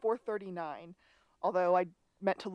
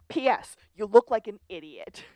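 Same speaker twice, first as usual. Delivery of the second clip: loud mock yell; retold scream; 3 semitones higher, 8 dB louder than usual. Podcast host retelling a father yelling at his son, mock yell. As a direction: call it out louder, half screaming. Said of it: "and then screams".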